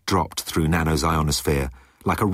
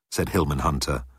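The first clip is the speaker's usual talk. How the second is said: ordinary delivery, nothing marked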